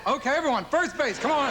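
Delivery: tour guide call. Retold or imitated. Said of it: "guy gets a hit".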